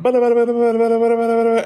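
loud, high speed-talking gibberish; rapid-fire auctioneer babble. Retold or imitated. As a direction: imitate an auctioneer racing through nonsense syllables. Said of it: "as fast as I could".

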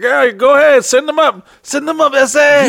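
high, hollered go-ahead; put-on high ride-operator voice; carnival carny. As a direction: call out as a carny sending the ride up. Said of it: "non-English speaking carnival carnies operating".

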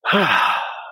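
long letting-go sigh; a slow release of breath. Real, not acted. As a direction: breathe out all the anger. Deep breath, mindset shift.

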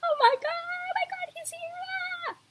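very high mock squeal of delight; high breathless fangirl gush; imagined fangirl squeal. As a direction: gush like a starstruck fan. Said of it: "who I'd be like".

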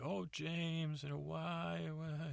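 lightly voiced pleading mother; mom voice. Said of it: "she's like".

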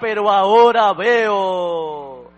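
loud, drawn-out shout of praise; imitating a healed girl's full-voiced shout; shouting at full lung. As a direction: cry it out jubilantly, stretching every word. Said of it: "empezó a gritar a todo pulmón".

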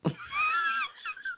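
shouted laugh. Real, not acted. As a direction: explode into a laugh. Laughs at "wash your hands.

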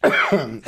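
loud leftover cough; short real cough while recovering; lingering cough. Real, not acted. Guest still recovering from choking.

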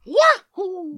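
very high jumping cry; Mario leaping into the air; Mario wahoo. Acted. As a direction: shout it high on the jump. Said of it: "the jump and then a wall bounce".

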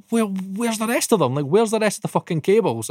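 high, exasperated boss voice, mimicked; mock angry boss. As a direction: imitate the angry boss demanding the cables.

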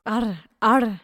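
trying to roll the R in "Arr, arr"; rolled R demo. Shown as a demonstration. Friend testing whether she can roll her R's. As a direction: trill the r in "Arr". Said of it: "roll his R's".

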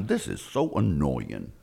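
low, soft grumble to himself; grumpy mutter. Retold or imitated. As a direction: grumble it under your breath. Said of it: "So he's driving through like".

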